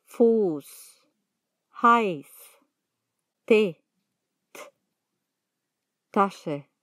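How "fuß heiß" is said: Fuß, Heiß ending on a voiceless "s". Shown as a demonstration. Teacher showing how the letter is pronounced, ß as "s" in Fuß, Heiß. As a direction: finish Fuß and Heiß with a clean hissed "s". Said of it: "La letra se pronuncia S".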